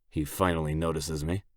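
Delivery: ordinary delivery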